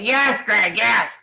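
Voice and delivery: mock her fed-up answer, loudly; mimicked exasperated reply.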